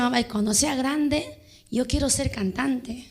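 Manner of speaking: softly retold child's wish; hushed, understated quote of a younger self; quiet childhood quote. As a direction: drop the volume to confide a wish to mamá. Retells her childhood words to mother.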